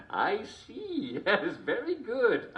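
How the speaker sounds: laughing realisation. Real, not acted. Host finally gets the joke.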